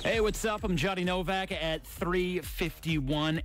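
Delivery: ordinary talk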